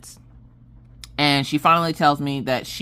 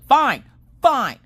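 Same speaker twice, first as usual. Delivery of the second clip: louder, high, falling "fine"; exasperated giving in; 10 semitones higher, 6 dB louder than usual. Retold or imitated. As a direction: throw it out in mock fury. Acted giving in after argument.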